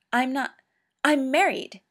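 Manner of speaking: Doctor hastily declining a setup, flustered. A narrator's reading. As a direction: blurt it out in a panic. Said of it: "he added quickly".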